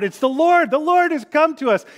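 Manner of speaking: high, loud, elated cry; calling out. Acted. Peter recognizing the risen Jesus from the boat, calling out. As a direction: call it across the water, pitch soaring. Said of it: "And he calls out".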